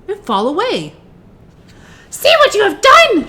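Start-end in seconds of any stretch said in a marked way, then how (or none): 2.1-3.3 very high, loud witch scream. A narrator's reading. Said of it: "she screamed".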